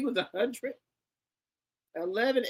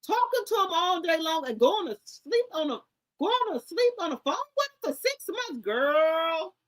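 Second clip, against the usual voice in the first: incredulous; 7 semitones higher, 5 dB louder than usual. Real, not acted. Telling viewers the boyfriend story, high incredulous disbelief. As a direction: stretch it out like you can't believe it.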